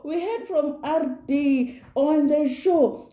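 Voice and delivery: slow emphasis. Real, not acted. Emphasising the reveal.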